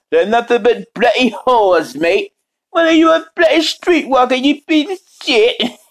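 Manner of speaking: high-pitched mock-outraged character voice; exaggerated high impression, swearing indignantly; Robotnik Cat sixty-nine. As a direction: scold in a put-on, high cartoonish voice. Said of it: "if you hear that voice what I just did".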